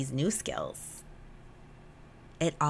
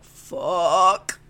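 long, loud drawn-out curse; loud realization; 10 dB louder than usual. Retold or imitated. Waking up to what went wrong, loud realization. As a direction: let the curse swell as it dawns. Retelling her morning moment of realization.

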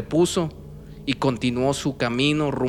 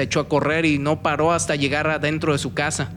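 ordinary delivery, nothing marked

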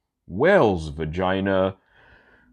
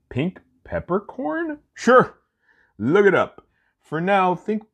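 high mock voice; 4 dB louder than usual. Jokey high pitched aside.

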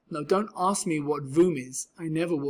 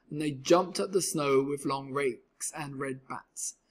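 ordinary delivery, nothing marked

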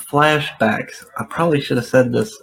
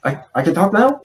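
stuttering droid; 6 semitones higher, faster than usual. Retold or imitated. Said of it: "He's kinda got a stutter".

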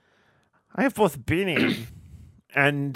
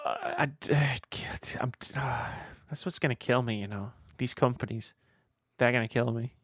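defeated mumble; 7 dB quieter than usual. Real, not acted. Mock despair, very quiet.

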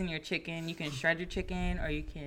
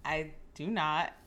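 ordinary talk, nothing marked

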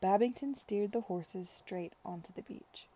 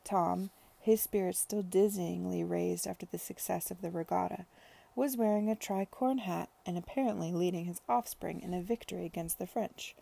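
ordinary delivery

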